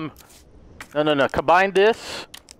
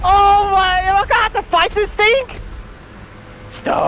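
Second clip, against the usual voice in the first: alarmed disbelief; 11 semitones higher than usual. Real, not acted. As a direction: cry out as the monster closes in. Facing the giant monster.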